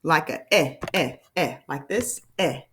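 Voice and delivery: eh said with an open mouth, repeated; mouth shape demo. Shown as a demonstration. Teacher modelling the short e sound. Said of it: "Remember how we have to move our mouth".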